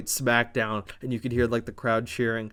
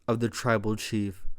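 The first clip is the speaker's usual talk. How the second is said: menacing low quote; 4 semitones lower, 6 dB quieter than usual. Retold or imitated. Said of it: "And Tonga leans in and says".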